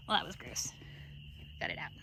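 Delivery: grossed-out whispered aside. Real, not acted. Owner after pulling grass from a ewe's nose, whispering. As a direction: whisper it under your breath, disgusted.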